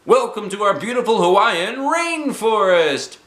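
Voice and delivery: high, loud chirpy guide voice; cheerful tour guide. Acted. Guide Donald welcomes the bus people, high and chirpy. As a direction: greet the tourists like a peppy guide.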